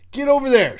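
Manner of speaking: mock yell. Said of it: "He calls everybody over".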